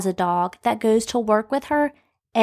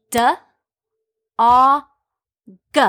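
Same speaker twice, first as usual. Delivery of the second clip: stretched sounds; 5 dB louder, faster than usual. Shown as a demonstration. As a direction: stretch each sound apart. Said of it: "I'm stretching that word out".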